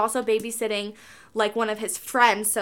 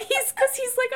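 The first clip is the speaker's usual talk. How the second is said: normal talking voice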